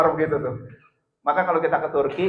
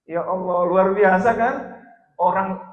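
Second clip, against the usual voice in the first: usual speaking voice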